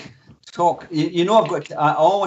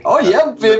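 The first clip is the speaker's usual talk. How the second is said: mock sultry; 6 semitones higher, 6 dB louder than usual. Hamming it up on camera.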